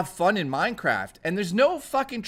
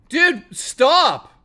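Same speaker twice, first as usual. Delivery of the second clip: exasperated shout; 9 semitones higher, 9 dB louder than usual. Real, not acted. Troll donation keeps coming in.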